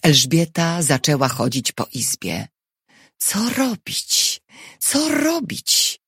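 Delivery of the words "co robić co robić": high, slow, drawn-out worry; helpless high-pitched lament; anxious repeating. A narrator's reading. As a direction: fret slowly in a raised pitch, at a loss. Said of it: "powtarzała".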